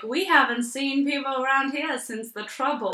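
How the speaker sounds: spooky small-town local. Retold or imitated. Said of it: "when you pull up to a gas station in this rundown town".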